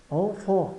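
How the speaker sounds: enfant as "an-fan", both vowels nasal, no final consonant; two nasal vowels. Shown as a demonstration. Teacher models the nasal vowels, enfant as two nasal syllables. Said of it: "nasais E N".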